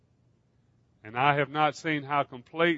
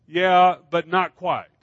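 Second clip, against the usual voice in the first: slow, much louder brush-off; dismissive retort; 4 semitones higher, 10 dB louder, slower than usual. Acted. Stick man brushing off God's offer, loud and slow. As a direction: push back with a loud, slow "but not quite".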